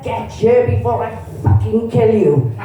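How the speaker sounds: ordinary talk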